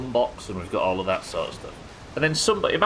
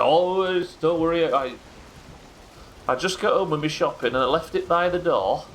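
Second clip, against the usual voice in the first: higher-pitched Yorkshire man making excuses; Yorkshire accent; 6 semitones higher than usual. Retold or imitated. Patient explaining himself to paramedics, Yorkshire accent. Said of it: "he's got a Yorkshire accent".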